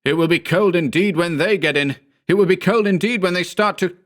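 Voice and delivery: high, raving, cut off; frantic. A narrator's reading. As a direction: rant in dread.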